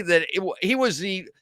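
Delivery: usual speaking voice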